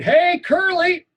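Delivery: a very high, slow, drawn-out yell; the bully keeps yelling at the boy. Acted. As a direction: stretch the call out loud across the yard. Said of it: "he keeps yelling".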